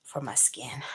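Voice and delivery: usual speaking voice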